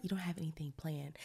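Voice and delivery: whispering to herself; hushed, breathy inner voice. Retold or imitated.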